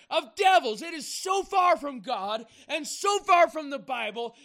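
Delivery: loud, high-pitched shouted rebuke from the pulpit; full-force preaching. Real, not acted. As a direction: thunder at the church to rouse it. Preacher denounces the Mass.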